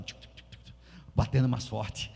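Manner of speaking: whispered heartbeat ticking; breathless whispered tic tic. Retold or imitated. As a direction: tick it out under your breath like a pounding heart. Imitates racing heartbeat.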